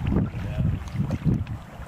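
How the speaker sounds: hushed, breathy repeat; eerie whisper. Shown as a demonstration. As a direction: whisper it like a spooky echo.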